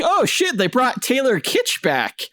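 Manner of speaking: high-pitched, retold fan astonishment; amazed realization. Fan spotting the card in the film, astonished. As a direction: start very high and stretch it out in wonder.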